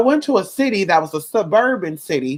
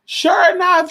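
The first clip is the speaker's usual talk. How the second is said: normal talking voice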